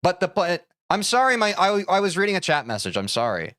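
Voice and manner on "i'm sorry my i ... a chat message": ordinary talk, nothing marked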